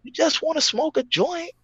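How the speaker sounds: high mocking voice. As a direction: sneer at the urge to smoke. Mocking voice continues.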